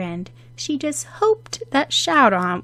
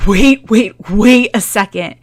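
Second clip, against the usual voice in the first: louder, cutting in; interrupting; 2 semitones higher, 11 dB louder than usual. Acted. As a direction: cut her rambling short. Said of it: "Shout-on interrupted".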